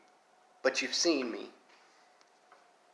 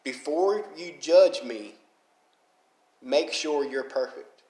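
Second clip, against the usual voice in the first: slow, emphatic repeat of the saying; saying it again, slowly, so it sticks; slow, deliberate quote; slower than usual. Shown as a demonstration. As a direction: drive it home slowly. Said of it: "And I'll say it again".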